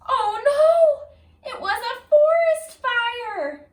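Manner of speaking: loud, high alarmed cry. Acted. Reader spotting the forest fire, loud alarmed cry. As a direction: gasp out the danger.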